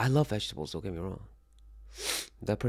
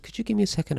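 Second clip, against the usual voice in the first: mock polite; 13 semitones higher, 5 dB louder, faster than usual. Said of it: "I'm like".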